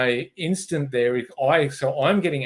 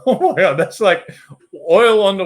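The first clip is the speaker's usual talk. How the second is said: loud laughing burst, much louder; laughing exclamation; 6 semitones higher, 10 dB louder than usual. Real, not acted. Laughing loudly at how calming someone down backfires. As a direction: laugh out the words in disbelief.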